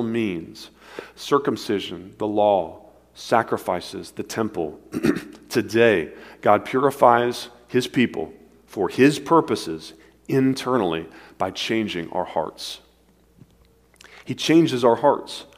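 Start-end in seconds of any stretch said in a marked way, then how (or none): none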